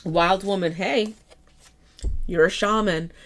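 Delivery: normal talking voice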